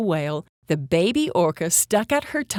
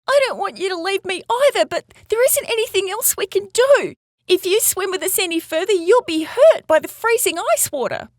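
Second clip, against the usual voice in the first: put-on high, urgent young voice; distressed baby orca; 12 semitones higher, 5 dB louder than usual. Baby orca warning her friend she can't follow, high urgent plea. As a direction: plead in a high, insistent child's voice.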